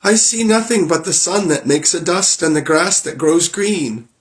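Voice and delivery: high voice of the younger sister calling down; sister Anne. Acted. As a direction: report from the tower, plainly disappointed.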